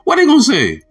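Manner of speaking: high, loud rhetorical challenge. Shown as a demonstration. Retaking the line for viewers, loud and high. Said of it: "I ain't mean to say that".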